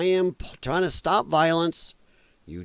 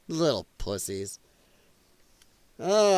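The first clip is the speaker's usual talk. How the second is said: ordinary talk, nothing marked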